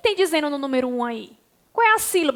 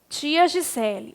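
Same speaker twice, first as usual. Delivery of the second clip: usual speaking voice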